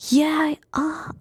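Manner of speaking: ordinary delivery, nothing marked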